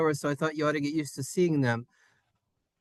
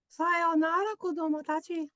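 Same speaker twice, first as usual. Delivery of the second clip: tiny high-pitched character voice; very high, thin little farewell with a hum; high squeaky farewell; 13 semitones higher, 3 dB quieter than usual. Acted. Japanese farewell voiced in squeaky puppet voice.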